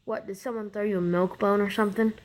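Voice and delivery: ordinary talk, nothing marked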